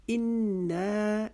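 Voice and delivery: انا very slow, the doubled noon hummed in the nose; nasal ghunna. Shown as a demonstration. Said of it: "انا کی نون مشدد کی وجہ سے ہم نے پہلے غنہ". (انا میں نون مشدد پر غنہ کے ساتھ آہستہ تلاوت)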